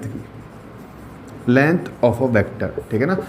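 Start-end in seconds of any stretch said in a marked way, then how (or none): 1.5-2.7 slow dictation. Shown as a demonstration. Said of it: "teacher dictating a definition".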